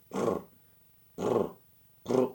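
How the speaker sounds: short sputtering brr by mouth; mower sound effect. Retold or imitated. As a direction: trill like an engine failing to start. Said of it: "How you like the sound effects here?".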